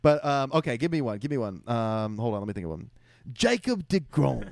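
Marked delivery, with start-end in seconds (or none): 3.3-4.5 slow, botched Irish-accent attempt; British explorer accent, badly done. Shown as a demonstration. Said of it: "just a bad Explorer accent".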